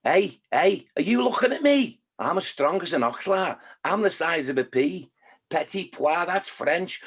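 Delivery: cocky little Scouse tough guy; feisty Scouser spoiling for a fight; angry Scouse ant. Acted. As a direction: pick a fight in a Liverpool accent. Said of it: "obviously they're all Scousers".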